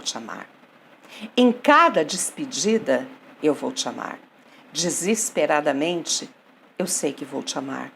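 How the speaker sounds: low, measured love poem with long pauses; poem recitation. Acted. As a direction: recite softly, sinking at each refrain. (declamação pausada e suave de poema)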